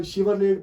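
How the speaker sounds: शिवरलेट as "shivar-layt", final t sounded; wrong "SHI-var-layt" for शिवरलेट; naive misreading. Shown as a demonstration. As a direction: read शिवरलेट off the letters with an i in the first syllable. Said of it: "actual word ये नहीं है".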